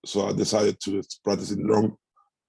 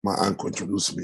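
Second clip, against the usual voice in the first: normal talking voice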